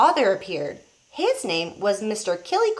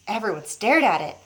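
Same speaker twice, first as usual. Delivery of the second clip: plain reading voice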